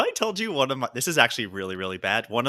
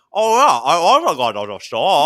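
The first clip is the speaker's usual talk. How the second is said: talking through a toothbrush, mushy and loud; toothbrush in mouth; 7 dB louder, slower than usual. Retold or imitated. Answering the roommate with a toothbrush still in his mouth. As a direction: mumble around a toothbrush. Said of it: "you can't do that voice".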